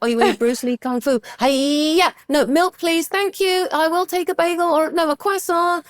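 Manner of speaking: playful impression of a fussy order; mock customer voice. Telling a friend about a café customer, high put-on voice.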